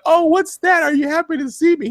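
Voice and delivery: very high falsetto woman's voice; put-on seductress. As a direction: coo it in a hammed-up, high flirty voice. Voices Mama Pope seducing a man.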